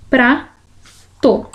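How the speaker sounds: "Prato" split into pra, pause, to; sílaba por sílaba. Shown as a demonstration. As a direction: sound out "Prato" in two separate syllables. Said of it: "duas sílabas aí". ("Prato" separado: pra, pausa, to)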